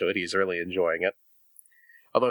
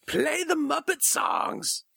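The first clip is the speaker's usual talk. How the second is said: high, drawn-out mock yell; heckler yelling; much slower than usual. Fan heckling the band from the crowd, mock yell. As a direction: holler it like a concert heckler, slowly. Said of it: "be that guy yelling out".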